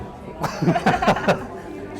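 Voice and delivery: short breathy chuckle at himself; light laugh. Real, not acted. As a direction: laugh it off, amused at his own age. Laughs at getting older.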